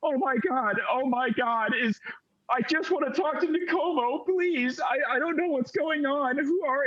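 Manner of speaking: put-on high, panicky little girl; freaking out. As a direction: plead in a small, high child voice. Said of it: "she is just freaking out".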